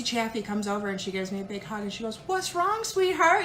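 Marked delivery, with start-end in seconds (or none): 2.3-3.4 high, sweet, motherly coo; Stacy's sweet voice. Retold or imitated. Stacy comforting the crying wife, high and sweet. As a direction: coo it like a kind friend, pitched up. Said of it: "You know how Stacy is in that voice".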